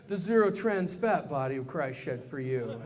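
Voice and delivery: low, soft mock communion voice; deep, hushed liturgical parody; preacher. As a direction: intone it solemnly like a priest. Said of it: "when the preacher said to us".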